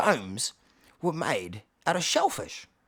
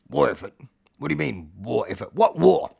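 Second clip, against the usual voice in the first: baffled questioning, building to loud; Alfie; 4 semitones lower, 5 dB louder than usual. Acted. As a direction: puzzle it out low, then burst out at the end. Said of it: "Alfie says".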